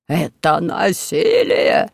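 slow, hoarse indignant wail; hoarse outraged cry. A narrator's reading. Captive monk protesting to Robin, hoarse outraged wail. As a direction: protest like a wronged cleric. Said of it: "вопил хриплым голосом один из монахов".

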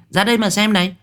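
put-on high child voice calling friends over; a kid yelling come look at this; mocking child voice. Children calling friends to watch the shaman, in a high child voice.

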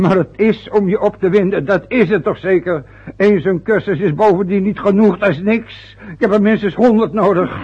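getting himself worked up about his lack of schooling. Acted. Father getting worked up in front of his wife. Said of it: "Vader, wind je nou niet weer zo op?".

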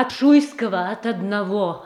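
high and loud pleading; urgent cry. Acted. Sister presses Boris that only Shuisky can save Moscow, urgent cry. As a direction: fling the name at him as the last hope.

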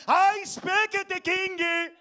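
put-on high, slow shout in a thick Spanish accent; mock clerk's accent. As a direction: holler it as the accented cashier. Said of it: "she looked at me and went".

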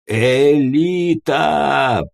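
roared alarm call. A narrator's reading. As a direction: bellow the warning, stretching the word out. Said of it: "взревел не своим голосом умник".

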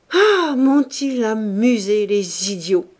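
crié fort et lentement, plus haut; un dernier éclat à pleine voix. Acted. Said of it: "cria Claude dans un dernier éclat". (Claude crie un dernier éclat, fort et lent)

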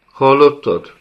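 high, loud, alarmed "Hallottad?"; startled question. Acted. Girl startled by a rumble in the dark temple, calling out high and loud. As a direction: jolt up in alarm. Frightened girl hears noise.

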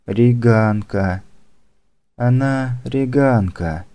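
slow, dazed and distant; drugged assassin voice. Acted. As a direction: drift out the words like someone drugged. Drugged assassin under questioning.

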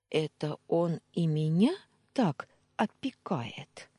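usual speaking voice

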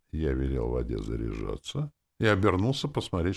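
plain reading voice

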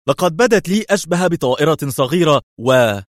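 high, eager cry cut off; rushing to describe it; excited exclaim. A narrator's reading. Third policeman marvelling, high eager cry. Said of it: "وهتف ثالث".

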